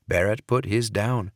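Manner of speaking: usual speaking voice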